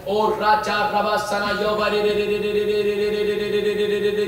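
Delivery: long monotone rolling syllable chant; praying in tongues. Real, not acted. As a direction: roll one syllable on and on. Tongues inside the prayer. (अन्य भाषा में एक सुर का जाप)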